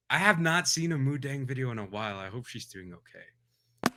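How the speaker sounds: ordinary delivery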